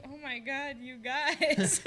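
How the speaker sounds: imitating a friend moved almost to tears; mock tearful. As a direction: play touched and teary, half laughing. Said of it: "he was ready to cry".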